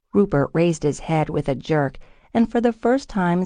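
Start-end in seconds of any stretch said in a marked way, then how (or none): none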